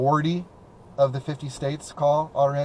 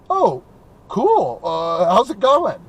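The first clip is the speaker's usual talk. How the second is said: higher, louder upbeat greeting; pleasantly surprised; 6 semitones higher, 7 dB louder, much slower than usual. Acted. Imagining a real person answering, pleasantly surprised. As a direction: react with pitched-up, delighted surprise.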